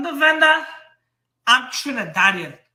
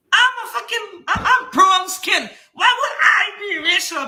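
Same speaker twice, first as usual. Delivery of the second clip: high-pitched, incredulous outburst; real exasperated denial, pitched high; indignant protest; 8 semitones higher than usual. Real, not acted. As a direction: protest heatedly, voice jumping up. Indignantly denies being called racial.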